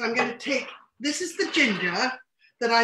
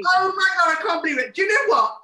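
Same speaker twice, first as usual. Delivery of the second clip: real excitement; 6 semitones higher, 6 dB louder, faster than usual. Real, not acted. Sushi turned out, overjoyed.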